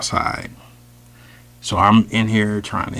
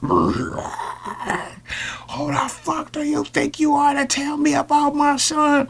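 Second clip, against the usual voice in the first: high furious shriek; screeching rage at the teacher; Hulk rage. Retold or imitated. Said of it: "turned into the Incredible Hulk".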